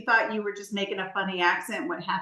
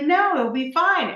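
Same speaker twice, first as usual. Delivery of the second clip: breezy reassurance; 7 semitones higher, 5 dB louder, slower than usual. Retold or imitated. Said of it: "And you're like".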